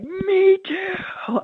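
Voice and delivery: very high, thrilled me too; eager Antonio. Retold or imitated. Pastor imitating Antonio's delighted reply, very high and excited. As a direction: squeal it out with delight. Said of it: "And he's like".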